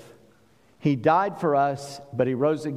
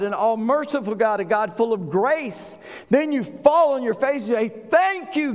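high, driving preacher's delivery; rousing sermon climax; impassioned preaching; 6 semitones higher than usual. Real, not acted. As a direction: preach it with building force, pitch climbing. Preaches at full force.

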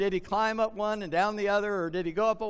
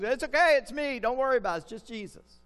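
casual reassurance; 5 semitones higher than usual. Shown as a demonstration. Preacher showing how Jesus really talked, casual and breezy. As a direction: toss it off like it's no big deal. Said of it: "But I don't think Jesus said".

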